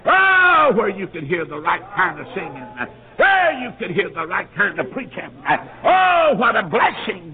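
high, loud, slow exultant cries; ecstatic preaching. Acted. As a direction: lift the crowd up in joy. Preacher rising to a climax.

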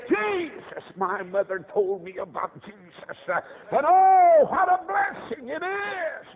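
high, drawn-out gospel cry; impassioned preaching. Real, not acted. Preacher thanking God for a mother's teaching, high fervent cry. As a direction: let the praise ring out in a preacher's wail.

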